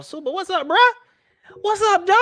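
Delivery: high, loud hype shout-out; hyped greeting. Real, not acted. Greeting a chat viewer, high hyped shout. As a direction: shout out the viewer with big energy.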